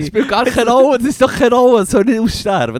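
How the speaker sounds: high, loud exasperated protest; loud comic indignation; indignant outburst. Real, not acted. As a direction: argue it heatedly, pitched right up. Protests about the animal dying out.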